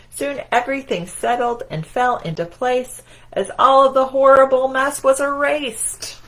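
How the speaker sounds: triumphant read-aloud. A narrator's reading. Climax of read-aloud rhyme.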